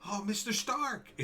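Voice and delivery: mock Spider-Man crying out to Stark; Peter Parker. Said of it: "he's doing Peter Parker's Spider-Man".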